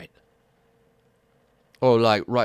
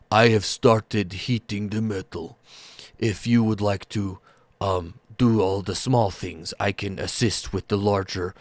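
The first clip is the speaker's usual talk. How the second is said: slow, welcoming big ogre voice; gentle ogre smith. Acted. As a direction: greet them warmly, slowly, like a giant.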